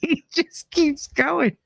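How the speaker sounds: high, squeaky mock laughing fit; crying with laughter. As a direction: act out being helpless with laughter, words dragged out. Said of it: "I'm crying 'cause I'm laughing so hard".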